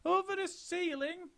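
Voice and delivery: baffled little monkeys crying out; squeaky astonished monkeys. A narrator's reading. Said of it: "they cried".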